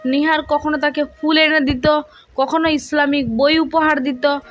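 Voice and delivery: usual speaking voice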